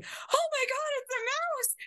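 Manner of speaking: piercing high-pitched shriek of fright; retold scream. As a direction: squeal it as if a rat ran over your feet. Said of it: "And I scream".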